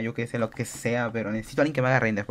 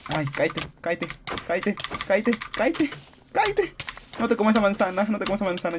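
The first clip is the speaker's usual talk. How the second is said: climbing very high, loud frantic pleas; will the enemy to fall; real frantic cries; frantic urging; 8 semitones higher, 3 dB louder than usual. Real, not acted.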